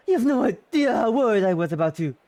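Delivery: put-on loud, emotional uncle in a French accent. Uncle hugs Irina, loud with relief, French accent. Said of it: "This is French again".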